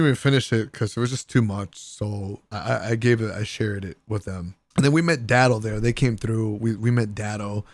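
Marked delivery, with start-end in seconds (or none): none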